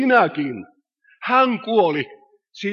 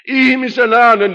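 louder, higher proclamation of the human voice; emphatic call; 4 semitones higher, 6 dB louder than usual. Acted. Preacher to congregation, crying out the human voice. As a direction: ring it out to the back pews.

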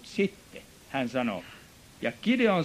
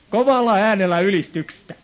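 suddenly much louder, pounding the words; loud preaching; 5 semitones higher, 11 dB louder than usual. Real, not acted. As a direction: belt it out like praise from the pulpit. Preacher speaks of loud praise. (kovaa saarnaten)

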